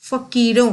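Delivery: फकीरों with an initial f sound; correct pronunciation. Shown as a demonstration. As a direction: begin फकीरों as fakeeron. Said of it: "तो यह बन जाएगा". (फकीरों में फ़ की आवाज़ निकालना)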